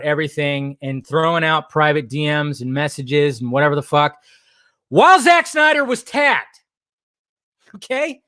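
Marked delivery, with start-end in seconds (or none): 4.9-8.2 higher and louder, shouting the point; frustrated shout. Real, not acted. Vlogger venting to viewers, shouting in frustration. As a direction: yell it out, fed up with the thread. Angry emphasis on tagged Snyder.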